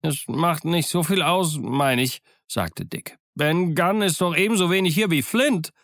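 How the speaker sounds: high, reasoning, lightly voiced; anxious young sailor. Dick tells the crew it hardly matters, lightly voiced. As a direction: argue it down nervously.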